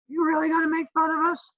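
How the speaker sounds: put-on high, squeaky kid asking; teasing high student voice; mock student voice. Imitating students' question.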